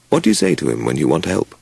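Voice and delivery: ordinary delivery, nothing marked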